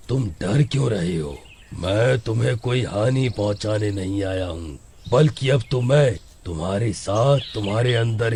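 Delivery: very deep, gloating demon; devil voice. Acted. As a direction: purr like a devil claiming a body. Devil speaks before possessing. (शैतान की गहरी, घमंडी आवाज़)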